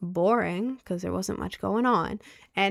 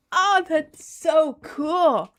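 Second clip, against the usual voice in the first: slow high gush; 8 semitones higher, 5 dB louder, much slower than usual. Real, not acted. Streamer gushing to chat about a grimoire tour. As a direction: stretch it out high and loud.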